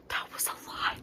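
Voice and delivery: breathless hushed whisper; stunned whisper. Real, not acted. Recapping the chapter to viewers, stunned whisper. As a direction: murmur it, still in shock.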